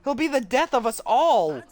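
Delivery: high, slow, alarmed demand; panicked accusing voice. Acted. Frightened student demanding the newcomer be locked up, high and alarmed. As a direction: cry out to lock him up.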